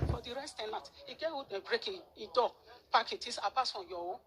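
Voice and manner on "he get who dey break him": normal talking voice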